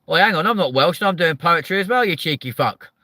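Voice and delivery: joking indignation; mock outrage. Real, not acted. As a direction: play offended at the cheek.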